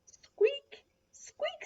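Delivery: very high little squeaks; cub squeaks. A narrator's reading. Little Lion back to the mouse, high squeaks. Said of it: "said Little Lion back to the mouse".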